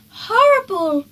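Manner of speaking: high, loud prim "Horrible"; a priggish little boy recoiling in disgust; little brother sneering at the fancy tents; Peter's disgust. A narrator's reading. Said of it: "said Peter".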